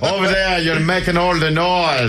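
hammed-up Irish brogue, slowed; mock Irish accent. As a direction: play a rowdy Irish fan. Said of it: "you would be like".

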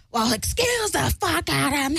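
high, mocking put-on voice; exaggerated indignant squawk; Skeletor impression. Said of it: "his long Skeletor, like, razor blade looking face".

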